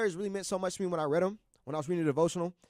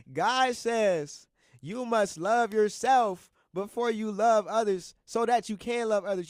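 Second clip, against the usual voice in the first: slow, louder, each word weighed like scripture; solemn recitation; 4 semitones higher, 6 dB louder, much slower than usual. Shown as a demonstration. Reciting his favourite verse to viewers, slow and louder. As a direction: proclaim the verse as if it will be tattooed on you. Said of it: "Ah, let me say it again".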